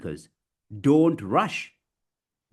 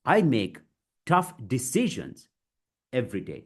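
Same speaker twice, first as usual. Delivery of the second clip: chunked delivery. Shown as a demonstration. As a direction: break it into deliberate chunks. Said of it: "Break it, guys".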